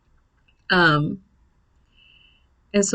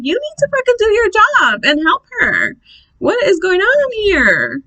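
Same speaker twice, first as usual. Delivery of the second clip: high-pitched, indignant re-enacted yell; retold yelling; 11 semitones higher, 3 dB louder than usual. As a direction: yell across the room at a negligent nurse. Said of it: "I'm over here yelling on the other side of the room".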